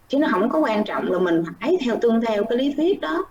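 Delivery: ordinary delivery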